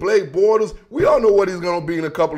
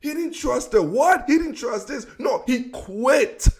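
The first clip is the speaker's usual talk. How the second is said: higher, incredulous questions; a mildly raised voice, refusing to buy it; 6 semitones higher than usual. Real, not acted. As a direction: scoff at the excuse in disbelief. Scoffing at the excuse.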